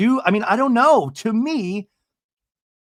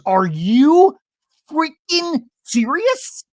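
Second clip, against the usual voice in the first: high, loud, drawn-out mock disbelief; Shannon; 12 semitones higher, 6 dB louder, much slower than usual. Imitating her reaction to the engagement ring, mock astonishment. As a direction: stretch each word in hammed-up shock. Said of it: "And Shannon goes".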